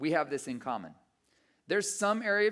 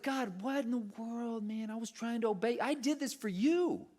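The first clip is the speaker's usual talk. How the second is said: frustrated complaint; 5 semitones higher than usual. Retold or imitated. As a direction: protest to God in exasperation. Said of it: "I remember being so frustrated".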